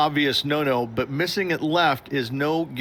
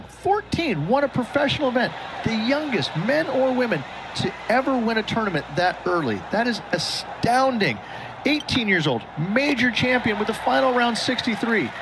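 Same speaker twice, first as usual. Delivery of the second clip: excited; 8 semitones higher than usual. Acted. Raving about a young champion.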